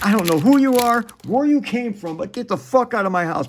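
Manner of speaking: high mock-frantic yell at a stranger; high hammed-up demand to get out; panicked outrage. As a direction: throw the intruder out in mock panic. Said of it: "it's just like".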